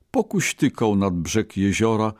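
plain reading voice

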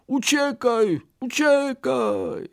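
high-pitched urgent warning; rabbit's cry. A narrator's reading. Scared rabbit warns his friend, crying out. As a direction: cry it high, stretching the last one. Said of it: "krzyknął".

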